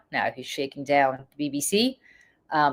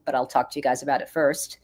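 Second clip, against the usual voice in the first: usual speaking voice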